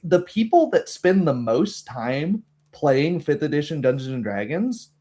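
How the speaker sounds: usual speaking voice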